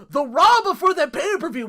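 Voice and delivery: loud, high burst of excitement; sudden loud high exclamation; excited outburst. Real, not acted. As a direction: blurt it out way up and loud. Excited rant opening.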